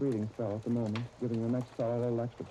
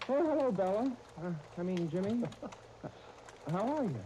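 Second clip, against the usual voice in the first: startled and covering up fast; nervous high greeting; flustered surprise; 9 semitones higher than usual. Acted. Caught talking to himself.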